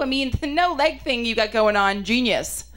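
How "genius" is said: ordinary talk, nothing marked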